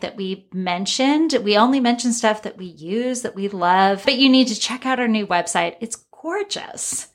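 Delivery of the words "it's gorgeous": high, slow, breathy gushing. Real, not acted. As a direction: swoon over how gorgeous it is. Gushing about new website.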